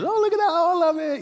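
high-pitched gushing squeal; all excited. Acted. Sophie showing the family her gift, all excited. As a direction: mimic a thrilled gift-opener up high. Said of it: "she's all excited".

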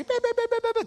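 high-pitched nonsense yapping; chihuahua yapping. Retold or imitated. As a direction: mimic critics as a yappy little dog. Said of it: "a chihuahua barking".

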